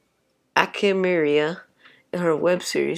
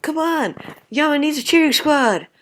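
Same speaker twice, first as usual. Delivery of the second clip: cheering; 8 semitones higher, 4 dB louder than usual. Acted. Cheering at eating contest.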